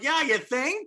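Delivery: sarcastic. Retold or imitated. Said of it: "Robert Downey Junior is just like".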